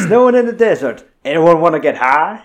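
excited joke. Real, not acted. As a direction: sell the gag with a loud lift. Joking about cocaine scene.

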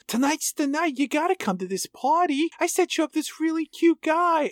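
eager waitress. Retold or imitated. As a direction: pitch it up like a gushing coworker. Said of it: "And Susie says".

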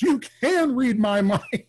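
high, laughing astonished exclamation; mock-amazed, pitch jumping up; retold amazement. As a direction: imitate someone astounded by a discovery. Retold friend realizes he sees remotely.

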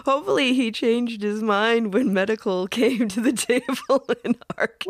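high, loud talking through laughter; laughing. Real, not acted. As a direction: laugh while you speak. Laughing at the story.

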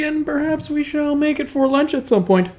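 high, airy mock-posh lilt; mock-genteel. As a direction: float it up high and hold it there. Mock-grand hosting aside.

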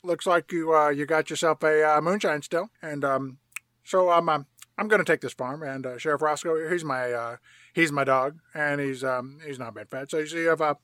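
halting, offhand mumbler full of ums; casual impression of a disengaged boss; Burt Reynolds. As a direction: make threats haltingly, as if barely bothering. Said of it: "And Boss Burt Reynolds says".

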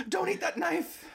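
high mock shriek; mock scream. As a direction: shriek it like a scolding panelist. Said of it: "will scream".

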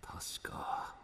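whispered inner thought; whispering. Acted. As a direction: breathe the words under your breath.

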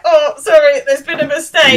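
high, loud mock-panicked apology; flustered apology. Simon backs off from angry girls, imitated flustered apology. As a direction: act out a boy hastily apologising.

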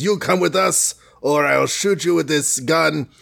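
menacing cartoon Russian accent; hammy Russian-accented threat; Boris Russian accent. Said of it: "I could do the Boris accent".

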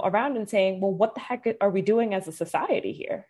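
ordinary talk

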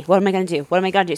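ordinary talk, nothing marked

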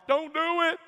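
high, loud scolding mama; shrieked-up mock motherly warning; imitated mama warning. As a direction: cry out like a mother from your past. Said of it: "You hear your mama".

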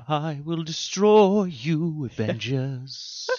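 slow, high crooning villain; sing-song villain threat; [name] as Thanos. Pretend. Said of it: "He'd be like".

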